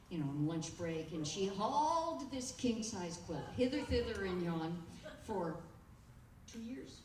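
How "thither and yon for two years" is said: ordinary talk, nothing marked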